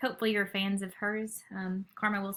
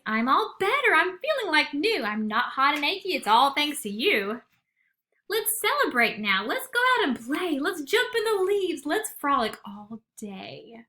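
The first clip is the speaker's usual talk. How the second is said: higher, louder happy bear; excited cry; 6 semitones higher, 5 dB louder than usual. Acted. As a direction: bounce with glee at being well. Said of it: "Bear cries".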